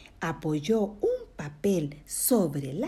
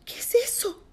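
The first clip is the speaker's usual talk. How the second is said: high-pitched startled question; asustado. Acted. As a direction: ask it high, frightened by a noise. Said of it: "Prendió la luz asustado". (pregunta aguda y asustada)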